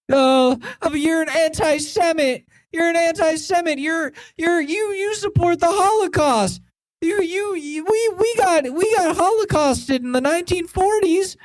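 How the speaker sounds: high, squeaky mock whine, far above her own voice; mocking whiny pro-Israel voice. Ranting vlogger mimics critics calling her antisemitic, squeaky mock whine. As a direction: parody the accuser as shrill and petty. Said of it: "And then they claim".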